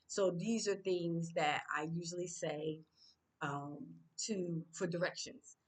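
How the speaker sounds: normal talking voice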